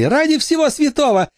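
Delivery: high, fussy, put-upon headmistress; shrill prissy headmistress; prim headmistress. A narrator's reading. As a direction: protest primly and fussily. Headmistress Crumley protests.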